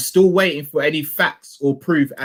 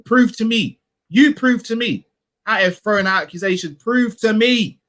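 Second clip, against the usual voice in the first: raised, demanding challenge; challenging; 7 semitones higher than usual. Real, not acted. Streamer challenging critics in chat, demanding. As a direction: dare them to prove it.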